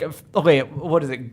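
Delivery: ordinary talk, nothing marked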